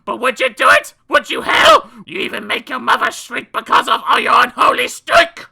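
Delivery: high, very loud shouting mother; furious mother. A narrator's reading. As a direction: rant at the smelly boy. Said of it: "The mother said".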